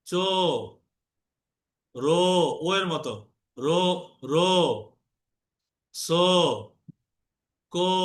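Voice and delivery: each syllable ends in a rounded o; বো said as bo, lips made round; o vowel drill. Shown as a demonstration. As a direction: say বো, জো, তো with a round o, not oo. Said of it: "এবার ও এর মতো করো".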